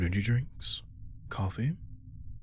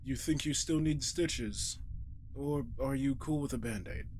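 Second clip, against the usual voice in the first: gentle; 6 semitones higher, 4 dB quieter than usual. Acted. Bandaging the familiar gently.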